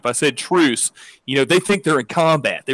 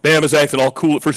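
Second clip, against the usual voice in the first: usual speaking voice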